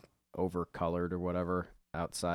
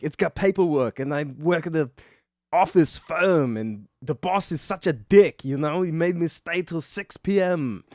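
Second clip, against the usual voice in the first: high, loud hammed-up complaining dad; mock husband excuse; 9 semitones higher, 10 dB louder than usual. Husband griping to his wife about his boss, loud mock whine. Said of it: "No, he's like".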